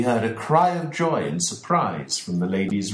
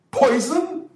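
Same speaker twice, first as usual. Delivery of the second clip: much higher, louder cry of disbelief; shocked shout; 6 dB louder than usual. A narrator's reading. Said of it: "shouted Mister Ferguson".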